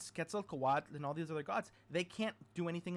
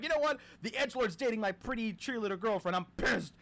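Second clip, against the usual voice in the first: mock rant, higher and louder; hammed-up big brother losing it, loud and high; pissed off brother; 5 semitones higher, 5 dB louder than usual. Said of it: "a pissed off older brother".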